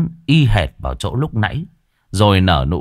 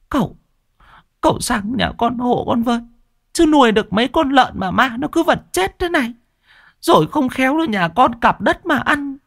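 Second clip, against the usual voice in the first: much higher, whining village woman; pleading woman. Acted. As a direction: plead for help, high and whiny.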